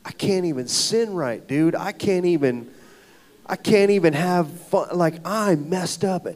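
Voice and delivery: loud, frustrated retelling; retold exasperation. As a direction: replay a worked-up protest to a friend. Complaining on a phone call.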